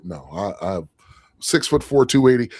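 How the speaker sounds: ordinary talk, nothing marked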